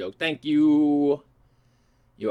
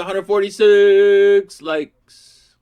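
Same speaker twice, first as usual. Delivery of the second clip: excited; 8 semitones higher, 10 dB louder, much slower than usual. Real, not acted. Reading comment, loud slow.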